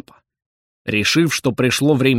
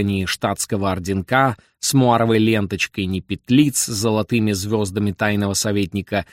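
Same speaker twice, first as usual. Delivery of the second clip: ordinary delivery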